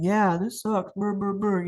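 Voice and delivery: a mock sulky mumbled agreement; grumbling along to her face; passive aggressive. Shown as a demonstration. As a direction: go along with her while sulking. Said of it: "Passive aggressive might be".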